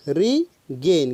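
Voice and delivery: Regain stretched very slowly, syllable by syllable; drawn out slowly. Shown as a demonstration. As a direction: spell out Regain slowly for the class.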